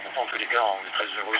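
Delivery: ordinary talk, nothing marked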